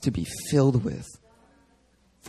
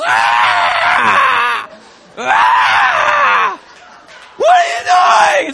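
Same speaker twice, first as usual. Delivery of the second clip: much higher, louder furious scream; furious shout; 7 dB louder, much slower than usual. Retold or imitated. Jesus driving sellers from the temple, screaming furiously. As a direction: roar it out in holy rage. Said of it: "And Jesus is".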